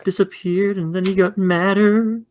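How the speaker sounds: put-on high, slow little-kid voice; timid child drawing out the account; scared Kenny. Preschooler telling teacher what happened, high slow timid voice. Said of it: "And Kenny's like".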